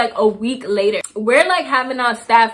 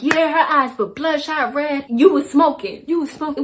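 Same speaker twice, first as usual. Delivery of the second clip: slightly higher mock imitation of an accuser; mocking the head counselor; 4 semitones higher than usual. Head counselor accusing her before staff, mock imitation. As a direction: parrot his accusation back with scorn.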